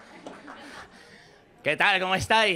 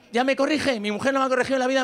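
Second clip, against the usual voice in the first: ordinary talk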